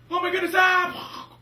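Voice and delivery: very high, loud hammed-up shriek; mock terrified scream. Pilot attacked by the giant bird, mock terrified scream.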